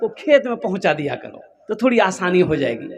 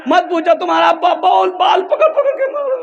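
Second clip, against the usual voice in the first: high, wailing wife's lament; wife playing hurt to her son, high wailing; mock tearful complaint; 10 semitones higher, 3 dB louder than usual. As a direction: whine it out as the wronged wife. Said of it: "और रोना शुरू कर दी".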